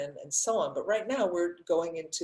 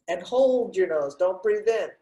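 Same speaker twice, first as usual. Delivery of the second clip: loud breath-hold command, pitched up; firm instruction; 3 semitones higher, 6 dB louder than usual. Real, not acted. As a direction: call it out firmly to a class holding its breath.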